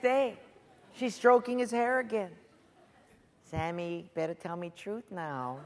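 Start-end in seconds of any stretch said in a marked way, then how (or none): 3.5-5.6 low, soft wheedling; hushed sweet-talk with a demand in it; coaxing. Acted. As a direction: press him gently, voice dropped. Delilah coaxing Samson again.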